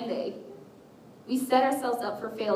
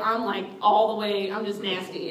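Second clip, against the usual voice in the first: ordinary talk, nothing marked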